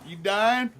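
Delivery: high, loud startled "You dying?"; mock-panicked question; alarmed question. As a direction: blurt it out in shock.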